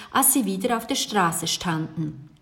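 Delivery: usual speaking voice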